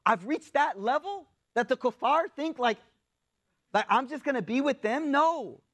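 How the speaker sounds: high, incredulous questioning; appalled on another's behalf; retold indignation. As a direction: pitch it up in disbelief, then refuse. Voices outrage at being courted.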